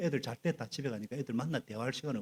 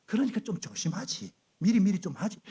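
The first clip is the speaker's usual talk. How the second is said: nagging blame; 7 semitones higher than usual. Retold or imitated. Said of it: "그런 말 하면 안 돼".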